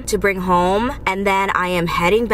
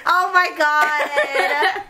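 laughing; 8 semitones higher, much slower than usual. Real, not acted. Laughing at vacuuming family.

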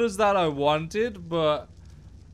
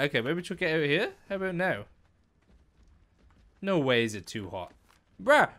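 normal talking voice

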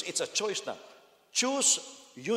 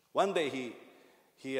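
ordinary talk

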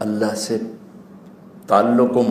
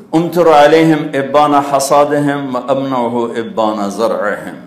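slow, louder solemn Arabic; Arabic recitation; 3 semitones higher, 4 dB louder, slower than usual. Acted. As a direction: recite it with weight. Quoting Arabic divine speech. (آہستہ عربی تلاوت)

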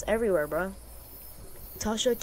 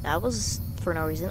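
normal talking voice

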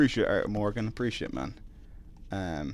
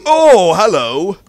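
startled hello; 8 semitones higher, 15 dB louder, much slower than usual. Real, not acted. Surprised by an enemy.

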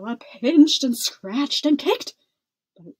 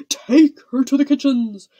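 loud, raised order; angry command; 4 semitones higher, 11 dB louder than usual. A narrator's reading. King stamping his foot, banishing daughter, angry command. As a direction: bark it loudly at the servants.

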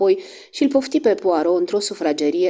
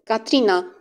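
commanding with a hint of disdain; one word that stops her cold; the lady of the house summoning the girl, coolly ironic; authoritative call; 6 semitones higher than usual. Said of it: "Se simțise autoritatea în vocea ei, dispreț și o undă de ironie".

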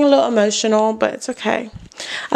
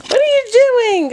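squeaky pet talk; 12 semitones higher than usual. Real, not acted. Cooing at her dog, high squeaky pet talk. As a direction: coo up high at the puppy.

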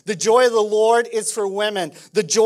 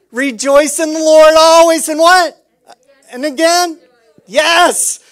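loud call and response; 7 semitones higher, 8 dB louder than usual. Real, not acted. Preacher leading the church in call and response, loud. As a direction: proclaim loudly, calling for the answer. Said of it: "Come, come with me, church".

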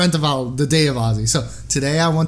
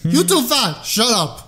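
put-on higher, blunt Russian-accented scolding; Russian grandmother; 6 semitones higher, slower than usual. Nurse insulting her patient, Russian grandmother accent. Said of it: "she's a Russian grandmother".

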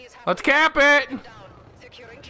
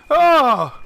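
ordinary talk

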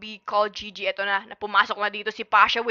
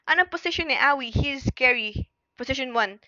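ordinary delivery

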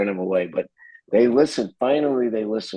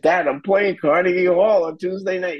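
high, bursting with pride; excited announcement; 9 semitones higher than usual. Retold or imitated. Son telling his father about Carnegie Hall, high and excited. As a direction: blurt out the big news to dad.

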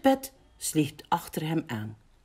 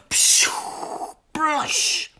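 whooshing, splashing sound effect; big splash noise; 12 semitones higher, 4 dB louder than usual. A narrator's reading. Mol jumps into the pond, imitated splash. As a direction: imitate a huge plunge into water. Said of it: "met een enorme plons".

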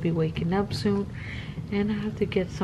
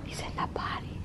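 whispering; 12 dB quieter than usual. Real, not acted. Whispered aside to the child.

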